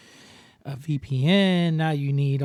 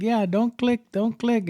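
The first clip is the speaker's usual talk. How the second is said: high-pitched mock warning; imitated alarmed scammer; 8 semitones higher than usual. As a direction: plead in mock alarm to stop. Imitating scammers stopping their victim.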